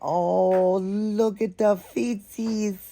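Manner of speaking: high, slow doting coo. Real, not acted. To viewers about the doll's feet, high baby-talk coo. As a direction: dote on the tiny feet.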